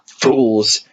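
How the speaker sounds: shouted. Acted. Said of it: "shouted Benjamin".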